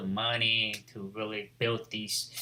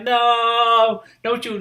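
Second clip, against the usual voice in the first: high, drawn-out "no", much louder; retold protest cry; 10 semitones higher, 8 dB louder, much slower than usual. Hero retelling his rival being thrown up, high loud cry.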